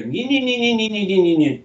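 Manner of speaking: high nasal-free motorbike whine, nin nin nin; imitated motorcycle buzz. Imitating motorbikes heard behind him. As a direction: mimic dirt bikes buzzing over dunes. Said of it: "all of a sudden I hear".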